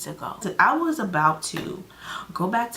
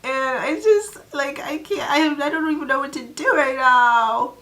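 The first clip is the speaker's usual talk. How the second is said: higher and louder, near-tearful wail; super dramatic; 8 semitones higher, 6 dB louder than usual. Retold or imitated. Telling the driver she wants home, dramatic higher wail. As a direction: overplay total helplessness. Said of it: "Super dramatic".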